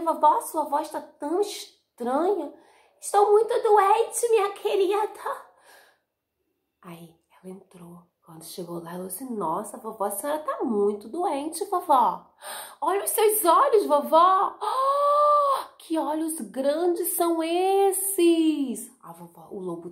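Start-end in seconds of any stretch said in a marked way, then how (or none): 0.0-2.5 put-on high, puzzled little-girl voice; a small girl noticing something odd; high wondering child. 3.0-5.5 put-on high, loud whiny sick old lady voice; wolf overplaying a poorly granny; hammed-up sick granny; fake sick grandma. 9.2-14.6 put-on high, louder astonished child; a small girl gasping at the sight; wide-eyed child voice; amazed little girl. 14.6-18.9 high cry then very slow wonder; amazed child shriek; shocked girl. Acted. Girl shocked at huge eyes.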